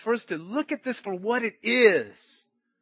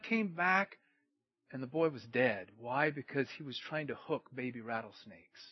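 ordinary talk

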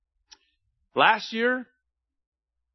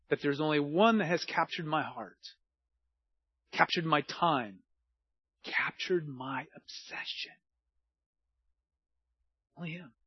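tender devotion; 2 semitones lower, 6 dB quieter than usual. Real, not acted. Devotion to God.

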